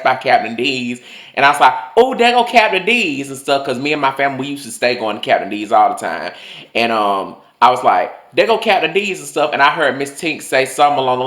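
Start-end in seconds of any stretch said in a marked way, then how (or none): 2.0-3.3 put-on high, excited child's call-out; excited kid. Excited child spotting a restaurant. 8.3-9.2 high-pitched, lightly excited call-out; excited kid. Retold or imitated. Excited child spotting a restaurant.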